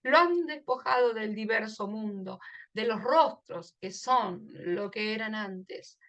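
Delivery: slow, soft recital of the poem with "lo han" joined; poetry reading. Shown as a demonstration. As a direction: read the poem gently, fusing "lo han". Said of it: "porque hay una sinalefa". (recitado lento y suave, "lo han" unido en sinalefa)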